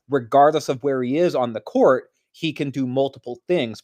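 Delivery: ordinary talk, nothing marked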